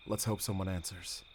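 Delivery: ordinary delivery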